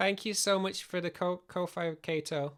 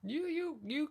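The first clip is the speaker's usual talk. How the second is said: squeaky high voice; 12 semitones higher, 4 dB quieter, slower than usual. Pretend. Playing with avatar voices.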